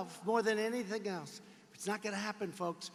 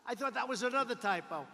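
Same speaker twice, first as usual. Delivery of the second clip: ordinary talk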